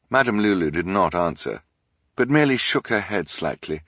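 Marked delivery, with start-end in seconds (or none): none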